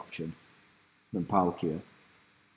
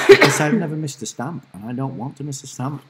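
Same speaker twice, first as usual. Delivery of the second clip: emphatic; 6 semitones higher, 8 dB louder than usual. Real, not acted. Emphatic about streak.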